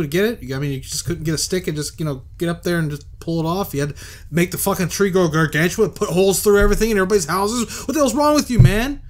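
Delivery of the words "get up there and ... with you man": loud, high, rising fury at the culprit; exasperated rant. Real, not acted. Gamer yelling at the tree-grower, furious and loud. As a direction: blow up at whoever wrecked the town. Player rants at game's villain.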